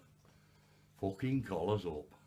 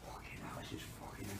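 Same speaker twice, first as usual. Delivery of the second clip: whispered swearing; 8 dB quieter than usual. Real, not acted. The man whispers curses over the bag. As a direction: whisper curses under the breath.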